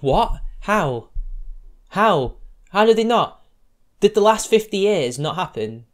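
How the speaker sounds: stunned outrage at the claim; incredulous. Real, not acted. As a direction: fire off the questions in disbelief. Reacting in disbelief to article.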